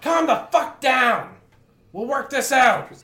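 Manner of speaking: high, loud yelled retort; frantic, forceful demand for calm; exasperated shout. Acted. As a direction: shout down an angry friend. [name] shouts back at Grok.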